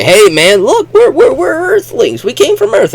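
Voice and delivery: very high, loud excited voice; mock boastful astronauts; eager Earthmen. Earthmen announcing themselves to Martians, high excited voice. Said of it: "They say".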